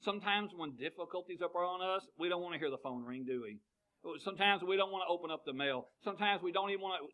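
ordinary talk

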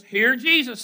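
emphatic pulpit slogan; rallying call. Real, not acted. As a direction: proclaim it to the congregation. Leads congregation in repeating title.

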